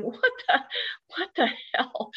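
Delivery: high-pitched, baffled reaction; stunned disbelief. Retold or imitated. Witness staring at the rising smoke, stunned disbelief. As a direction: let the words stall in disbelief.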